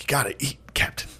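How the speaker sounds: breathy whispered coaxing; whisper, urging him to eat. Retold or imitated. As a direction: coax him softly in a whisper. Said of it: "He's like".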